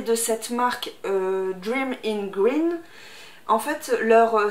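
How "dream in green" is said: English accent. Shown as a demonstration. Repeats the brand name in English.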